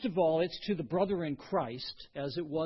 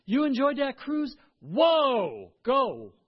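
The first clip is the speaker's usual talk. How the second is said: loud, bright cheer for a friend's cruise; an over-the-top "whoa, go!"; mock enthusiasm; 7 semitones higher, 9 dB louder, slower than usual. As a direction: ham up being thrilled for them. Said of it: "you say".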